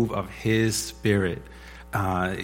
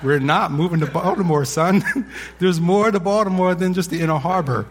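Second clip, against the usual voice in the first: higher, louder mock-firm dad voice; amused dad; 7 semitones higher, 4 dB louder than usual. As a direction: playfully shut down the excited son. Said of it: "And I'm like".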